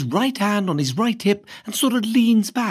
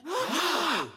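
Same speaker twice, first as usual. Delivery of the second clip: sharp, voiceless class gasp; shocked gasp; 9 semitones higher, 7 dB quieter than usual. A narrator's reading. Boys hearing master insulted, shocked gasp. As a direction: gasp as if scandalised.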